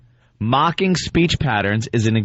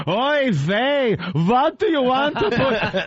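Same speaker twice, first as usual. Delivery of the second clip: put-on high, slow, laughing Yiddish accent; hammed-up Yiddish lawyer voice, giggling through it; 14 semitones higher, slower than usual. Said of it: "I could see him typing this up".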